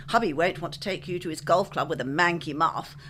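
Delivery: ordinary talk, nothing marked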